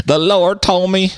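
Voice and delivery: a mock televangelist begging the flock for jet money; televangelist impression. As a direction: ham it up as a greedy TV preacher. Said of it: "I like what the televangelists do".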